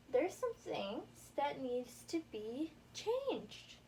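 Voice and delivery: ordinary talk, nothing marked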